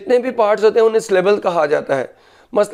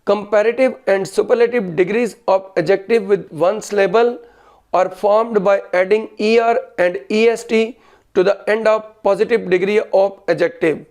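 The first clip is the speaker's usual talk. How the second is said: slow, word-by-word dictation; dictating; much slower than usual. Real, not acted. Teacher dictates grammar rule to students, slow dictation. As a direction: read it out slowly for note-takers.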